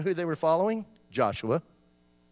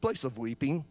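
deadpan answer; 4 semitones lower, 5 dB quieter than usual. Acted. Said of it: "Where are you from?".

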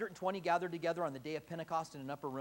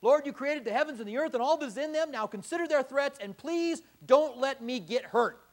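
higher and louder, a fast anxious plea slowing on the last words; self-serving plea; 8 semitones higher, 8 dB louder than usual. Pretend. Preacher mocking his own fearful prayer, loud pleading. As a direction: beg God to keep you safe, hammed up. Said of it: "this is how I would have prayed".

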